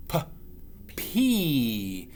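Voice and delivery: P said crisply, a puffed p sound; letter P sound. Shown as a demonstration. Teacher models the letter sound P. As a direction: sound out P for the children. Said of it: "starts with the letter P".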